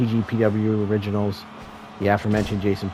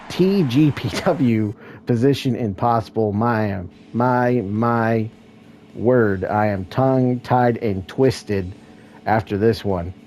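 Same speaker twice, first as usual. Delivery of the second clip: usual speaking voice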